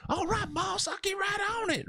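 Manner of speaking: very high-pitched Louisiana accent impression; Corn Cob. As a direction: squeal it as an eager Louisiana beanpole. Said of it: "had the highest pitched voice in that Louisiana accent".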